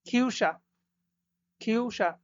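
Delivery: খিউসা slowly, ü rounded, ch hissed; German Küche. Shown as a demonstration. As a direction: model খিউসা twice. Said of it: "স এর মতো উচ্চারণ হবে".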